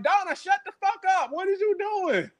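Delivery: high, sharp mock yell at Donna; exasperated yell. Sky yelling at Donna to shut up, high exasperated yell. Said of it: "Sky was like".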